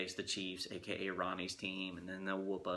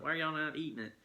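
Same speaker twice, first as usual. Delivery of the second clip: higher puzzled aunt voice; imitating aunt; 7 semitones higher, faster than usual. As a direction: ask it like a confused aunt at dinner. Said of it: "She was like".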